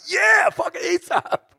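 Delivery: high, loud, drawn-out whoop; retold rowdy cheer. Singer cheering trap music to a bummed bus, high excited shout. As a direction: yell it slowly like a drunken fan.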